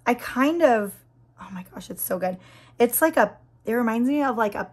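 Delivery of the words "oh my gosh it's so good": breathless whisper of delight. Real, not acted. Nail vlogger to viewers, gushing in a whisper. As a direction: whisper it like a secret treasure.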